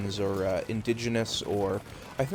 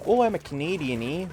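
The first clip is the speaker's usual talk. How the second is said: mock Canadian; 5 semitones higher than usual. Streamer joking to chat, hammed-up Canadian accent. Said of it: "That's why I raise my U's".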